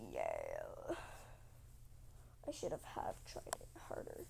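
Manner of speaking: hushed, whispered admission; sheepish whisper. Acted. He admits he should have tried, sheepish whisper. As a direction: whisper it, low and quick. Voicing a regretful character.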